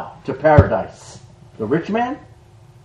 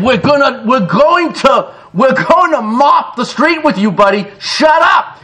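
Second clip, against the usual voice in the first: mock thug threat; 6 semitones higher, 3 dB louder than usual. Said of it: "And they said".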